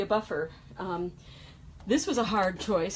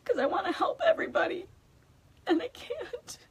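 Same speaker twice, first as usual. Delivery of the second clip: high, fast, then crying through it; real tears break through at the end; 12 semitones higher than usual. Real, not acted. Admitting to viewers she can't help everyone, crying.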